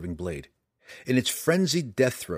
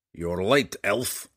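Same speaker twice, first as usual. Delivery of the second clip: gruff dwarf, louder and rough; gruff gravelly dwarf voice; 3 dB louder, much slower than usual. A narrator's reading. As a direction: growl it like a grizzled old dwarf. Dwarf Bruenor speaks.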